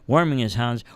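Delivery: ordinary delivery, nothing marked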